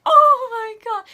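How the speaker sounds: high, slow, breathless oh my God; overwhelmed awe at the film; amazed gasp. Retold or imitated. Vlogger reenacting her awe at the cinema, high and slow.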